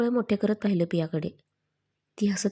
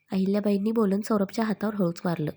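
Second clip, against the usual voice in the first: usual speaking voice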